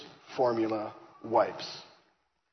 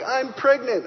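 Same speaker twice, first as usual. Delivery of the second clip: laughing and crying; 12 semitones higher, 6 dB louder, slower than usual. Retold or imitated. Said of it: "We're laughing and crying on the phone".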